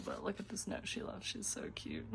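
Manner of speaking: soft, touched whisper. Real, not acted. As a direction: whisper it, moved. Reading mom's note.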